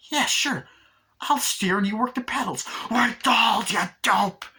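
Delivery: high mock reply building to a shout; sneer, then yell the insult; imitated exasperated doll; sarcastic outburst.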